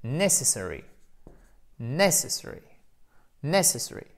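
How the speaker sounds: NESS stressed in each "necessary"; first-syllable stress. Shown as a demonstration. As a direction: repeat "necessary" as a drill, accent up front. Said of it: "On va le décomposer". (« necessary » répété, accent sur la première syllabe)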